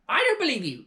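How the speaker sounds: high, loud hag refusal; scoffing crone. Acted. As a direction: dismiss him flatly. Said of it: "she answered".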